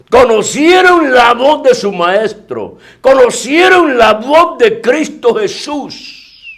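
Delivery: high, slow and loud proclamation; fervent preaching. Acted. Preacher proclaims to his congregation, slow and fervent. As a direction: proclaim it slowly to the whole church.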